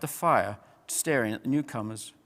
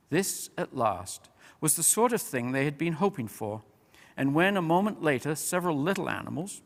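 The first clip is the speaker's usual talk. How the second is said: ordinary delivery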